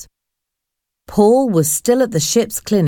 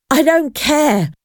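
between breaths; 7 semitones higher than usual. A narrator's reading. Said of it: "he said between breaths".

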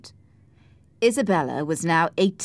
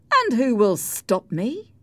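slow, higher-pitched taunt; over-the-shoulder challenge; 7 semitones higher, 3 dB louder, slower than usual. A narrator's reading. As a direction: throw out a dare while walking away. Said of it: "he said over his shoulder".

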